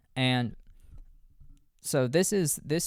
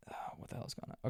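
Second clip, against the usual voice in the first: whispered aside; 13 dB quieter than usual. Real, not acted. Reacting to the film scene.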